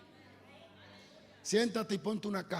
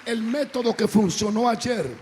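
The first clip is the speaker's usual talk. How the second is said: loud preacher's proclamation; full-force preaching; 11 dB louder than usual. Real, not acted. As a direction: drive it out to the congregation. Preaches a maxim to congregation. (predicación a viva voz)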